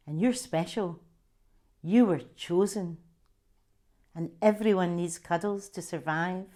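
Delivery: an author reading her memoir aloud; story narration. Acted. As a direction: tell the childhood scene as a gentle story reading. Reads her memoir extract aloud.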